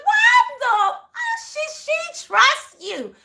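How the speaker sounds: high, loud outburst, dragging slowly on the second line; blew up. Retold or imitated. She retells blowing up at the man who expects trust, a high loud outburst. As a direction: explode at him, pitch way up. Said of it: "I blew up and I was like".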